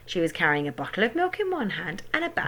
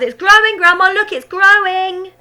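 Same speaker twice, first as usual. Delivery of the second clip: excited yell; 12 semitones higher, 12 dB louder than usual. A narrator's reading. George yelling to Grandma about the hen. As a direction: yell it in delight. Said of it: "he yelled".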